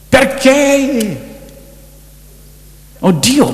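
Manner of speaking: high, pleading anguished calls; retold desperate crying out. Preacher kneeling by the bed cries out with her, high anguished pleading. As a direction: beg heaven to stop her pain. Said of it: "cominciato anche io a gridare assieme con lei".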